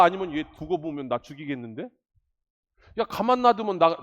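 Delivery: ordinary delivery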